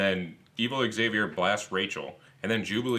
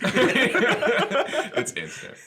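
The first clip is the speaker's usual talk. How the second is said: very high, slow words through laughter; laughing; 7 dB louder, much slower than usual. Real, not acted. Friend laughing at the boner joke, high laughing words. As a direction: squeeze it out while cracking up. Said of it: "laughing after the joke".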